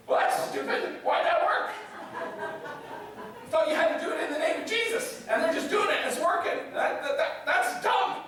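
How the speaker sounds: high-pitched, baffled outrage; mock indignation. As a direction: protest it like a jealous, offended believer. Acting out Christians resenting others' success.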